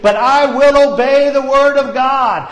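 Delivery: shouting, high and loud declaration; preaching at full force. Acted. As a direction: thunder it out like a revival preacher.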